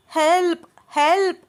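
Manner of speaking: high, drawn-out shout; loud cry for help. A narrator's reading. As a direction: shout for anyone to come and help. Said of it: "అని గట్టిగా అరుస్తోంది అను".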